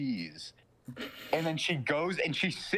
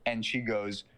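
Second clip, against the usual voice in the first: usual speaking voice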